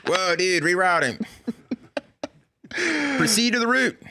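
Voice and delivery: excited dude navigation voice; Californian GPS voice. Pretend. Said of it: "your Californian's voice".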